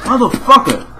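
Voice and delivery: high, frustrated curse; angry high yell. Real, not acted. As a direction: spit it out furious. Getting hit.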